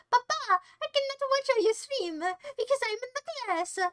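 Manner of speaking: high-pitched mock Polish accent. Polish kid making excuses to father, Polish accent. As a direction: whine it in a put-on Polish accent. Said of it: "was that a good Polish accent?".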